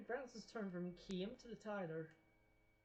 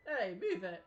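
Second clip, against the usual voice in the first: shouting; 7 dB louder, slower than usual. Acted. Angry men blocked in road.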